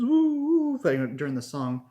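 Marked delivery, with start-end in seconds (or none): 0.0-0.8 high, sustained mimicked woo; imitated "woo". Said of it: "they were like".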